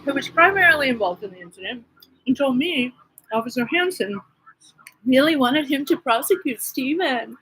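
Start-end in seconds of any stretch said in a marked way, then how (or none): none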